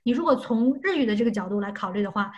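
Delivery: ordinary delivery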